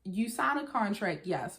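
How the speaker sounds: usual speaking voice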